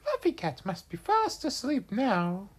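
high, squeaky little bird voice; small bird thinking aloud; bird character. Acted. Bird character's line in story.

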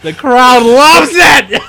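shouting through laughter, slow; laughing shout. Acted. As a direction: shout it while cracking up. Laughing mock announcer line.

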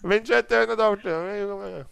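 slow, high put-on French accent; mock French accent. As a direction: roll out the name in a French accent. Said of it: "My accents have blended".

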